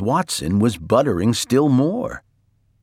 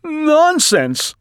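very high, loud, unconvinced protest; frightened denial; 5 dB louder than usual. A narrator's reading. Mister Watson dismissing the earthquake, frightened high cry. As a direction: deny it while clearly scared. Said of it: "He sounded frightened".

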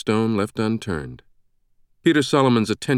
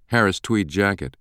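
ordinary delivery

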